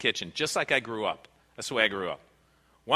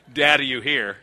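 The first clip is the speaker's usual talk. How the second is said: louder retold call for dad; crying out; 7 dB louder than usual. Child calls dad within the caller's hearing, mildly crying out. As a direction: imitate a kid's call, only mildly. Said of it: "this voice cries out".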